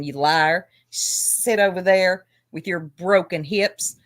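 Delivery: usual speaking voice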